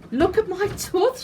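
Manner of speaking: put-on high, proud child voice; giggly girl showing off her tooth; high excited child; little girl declaring. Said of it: "she declared".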